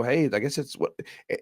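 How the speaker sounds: normal talking voice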